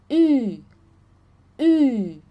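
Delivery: a rounded ü sound; rounded German ü. Shown as a demonstration. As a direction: pout and say i for Ü. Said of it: "fazer o biquinho e você vai falar".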